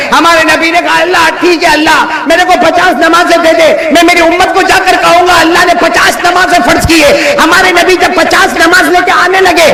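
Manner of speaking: high, ringing proclamation; full-force preaching. Acted. Preacher declares the command of prayer to the faithful, high full-force preaching. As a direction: proclaim it to the whole congregation.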